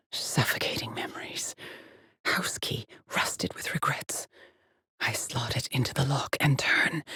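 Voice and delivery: hushed, secretive whisper; haunted whisper. Acted. Explorer slotting a key rusted with regrets, whispering. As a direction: whisper it like a suffocating memory. Dark inner voice, memories.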